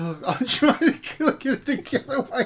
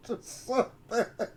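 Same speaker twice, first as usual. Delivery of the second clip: laughing softly; 8 dB quieter than usual. Real, not acted.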